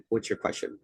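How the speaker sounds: breathy, near-whispered question; an official quietly asking a heated coach for his question; hushed question. Retold or imitated. Official's retold reply to coach.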